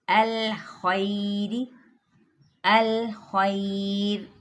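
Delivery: slow recitation, light thin र; thin ra drill. Shown as a demonstration. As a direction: recite each word slowly with a thin र. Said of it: "बारीक रॉ की तरफ आते हैं". (बारीक रॉ की धीमी मश्क)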